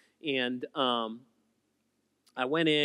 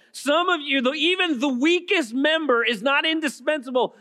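fervent revival preaching, voice ringing; full-force preaching; 12 semitones higher, 8 dB louder than usual. Acted. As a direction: preach it out to the whole room.

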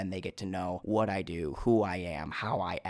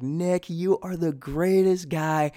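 put-on drunken gushing praise; 8 semitones higher, 6 dB louder than usual. Tipsy friend raving to the party, loud and high. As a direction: ham up the tipsy friend raving about you.

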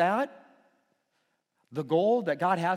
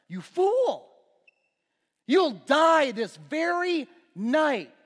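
much higher, louder, slow stern rebuke; voice of God; 9 semitones higher, 6 dB louder, much slower than usual. Retold or imitated. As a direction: thunder down the judgement slowly. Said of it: "And God says".

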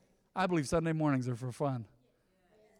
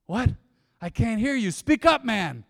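higher and louder, urging the crowd; mock cheerleading shout; 5 semitones higher, 6 dB louder than usual. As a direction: yell for them to speak up. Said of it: "I'm all".

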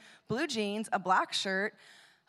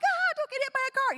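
much higher, louder alarmed yell; alarmed call; 4 dB louder than usual. Retold or imitated. The team yells after the boy running across the lot, higher and louder. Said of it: "our team's like".

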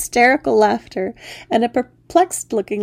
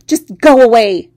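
"Just go away!" yelled much louder, "go" punched; shout; 2 semitones higher, 12 dB louder than usual. Acted. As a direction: scream it at the frog. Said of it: "I shouted".

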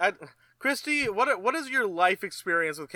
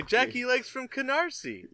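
movie line impression; 7 semitones higher than usual. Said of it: "in the movie they say".

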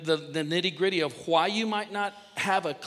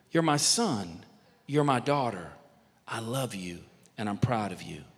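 tender blessing; 9 semitones lower, 4 dB quieter than usual. Acted. Father praying over his children, low tender blessing. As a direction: settle gently downward with pauses between phrases.

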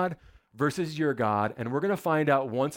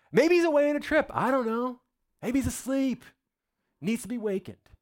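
much higher, quick sarcastic taunts; mocking; 10 semitones higher than usual. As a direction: ridicule a god who won't show up. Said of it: "Elijah began mocking them".

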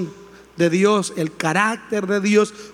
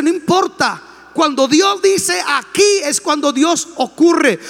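fiery preaching; 7 semitones higher than usual. Acted. The pastor rebukes frustrated Christians, shouting from the pulpit. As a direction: preach it hard, building to shouts.

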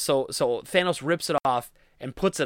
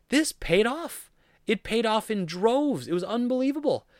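much higher, slow, savoring each word; thrilled; 8 semitones higher, slower than usual. Real, not acted. Fan raving to viewers about the ending, thrilled. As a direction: gush like you can't believe it.

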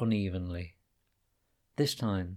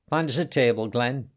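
raised voice calling ahead; shouted call; 4 semitones higher, 6 dB louder than usual. A narrator's reading. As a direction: call across the cafeteria, louder. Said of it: "she shouted".

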